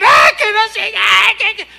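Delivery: high loud wailing cry; mock ecstatic outburst. Preacher imitating a worshipper's outburst, loud high wail. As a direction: ham up a disorderly outburst in church.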